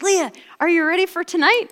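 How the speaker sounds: high, loud, eager question; excited co-captain. Retold or imitated. Co-captain asking her teammate about tonight, high and excited.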